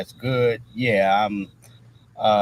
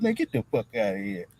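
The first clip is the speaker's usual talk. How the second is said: softer, brushing-off retort; quoted dismissal; 5 dB quieter than usual. Retold or imitated. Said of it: "he said".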